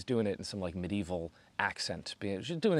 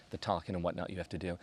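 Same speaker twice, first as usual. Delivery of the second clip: usual speaking voice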